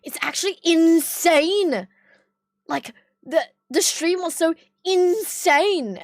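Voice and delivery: slow, drawn-out friend impression; lightly voiced friend, stretched words; imitating friend. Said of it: "No, how he says, he goes".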